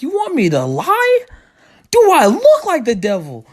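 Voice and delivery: high-pitched, scandalized questions; mock indignant. Brother asked to lie to mom, mock indignant.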